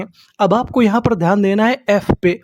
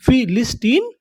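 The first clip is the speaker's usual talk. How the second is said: फिलिस्टीन in parts, rising at the end; measured breakdown of फिलिस्टीन; syllable by syllable; 2 semitones higher than usual. Shown as a demonstration. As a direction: sound out फिलिस्टीन with the last part lifted. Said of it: "उसे हम लोग क्या बोलते हैं".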